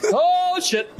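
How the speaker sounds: high-pitched mock "oh no" yelp; startled cry. Game master voices civilians seeing armed runners, startled. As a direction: exclaim it like a startled bystander.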